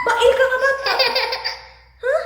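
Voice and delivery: high, loud pressing question; mock scolding. Real, not acted. Same question pressed on child. (malakas at mataas na pag-uusisa)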